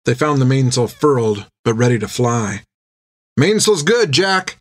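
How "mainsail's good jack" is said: high-pitched call to the captain; raised-pitch yell, held back in volume; mild yell. A narrator's reading. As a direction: call it down from the rigging. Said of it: "yelled Finn".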